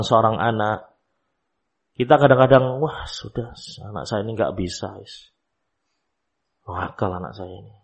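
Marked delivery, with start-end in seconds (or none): 2.8-7.7 quiet, low sigh of giving up; resigned parent. Acted. Parent giving up on a naughty child, resigned. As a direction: mutter it softly, as if defeated.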